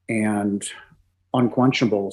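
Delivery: usual speaking voice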